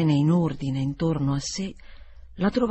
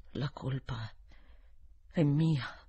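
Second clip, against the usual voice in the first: quiet confession; 4 semitones lower, 6 dB quieter, slower than usual. A narrator's reading. Gross confesses, turning pale.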